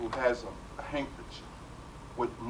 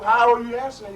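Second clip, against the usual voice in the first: indignant demand; 9 semitones higher, 9 dB louder than usual. Retold or imitated. Seeker's indignant question.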